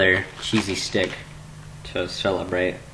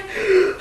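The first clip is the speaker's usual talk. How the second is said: high startled gasp; mock scared gasp. Vlogger scared by the blank screen, mock gasp. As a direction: gasp in exaggerated fright.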